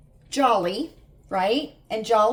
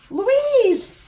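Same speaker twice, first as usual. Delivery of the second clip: high, loud call of a name; yelling down; 12 semitones higher, 6 dB louder than usual. Retold or imitated. Mother yelling down to Louise about the kitten, a high loud call. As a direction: call her name down the stairs. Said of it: "And I yelled down to Louise".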